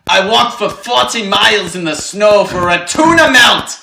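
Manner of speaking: put-on loud, high German accent; hammed-up German old man. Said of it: "I wish I knew how to do a German accent".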